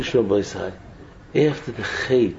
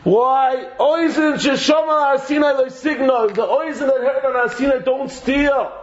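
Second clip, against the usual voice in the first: preaching shout; 9 semitones higher than usual. Real, not acted. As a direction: call it out to the room with full force. Preacher cries out the verse.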